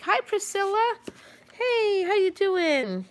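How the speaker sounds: high bright hello, slowing on how you doing; cheery greeting. Real, not acted. Vlogger greets a viewer in chat with a high cheery hello. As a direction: greet a friend who just dropped in.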